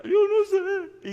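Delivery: high wailing sobs, loud at first and then fading; imitating his sobbing. The crying man answers the pastor, high sobbing wail. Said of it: "Y sigue llorando".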